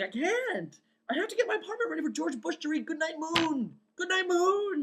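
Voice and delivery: very high, frantic wail; freaking out. Retold or imitated. Dreamer refusing friends' invitations before the big reading, freaking out. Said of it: "I'm, like, freaking out".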